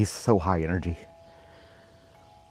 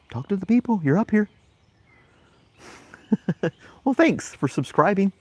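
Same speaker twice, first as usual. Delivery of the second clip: much higher, coaxing the dog toward camera; 10 semitones higher than usual. Real, not acted. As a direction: sweet-talk the dog to the lens.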